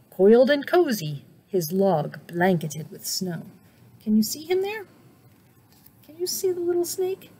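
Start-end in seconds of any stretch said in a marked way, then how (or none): 4.0-7.3 put-on lilting high question to kids; high, playful question. A narrator's reading. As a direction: ask it up high and light. Reader asks children, high.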